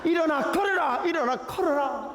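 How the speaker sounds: high ringing commanding call; preaching command. Retold or imitated. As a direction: proclaim it like a healer. Pastor commands healing loudly. (높고 힘찬 명령조 선포)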